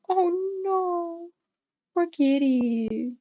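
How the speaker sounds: high, slow cooing over a kitty. Real, not acted. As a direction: coo with sympathy for the poor cat. Pitying the hurt cat.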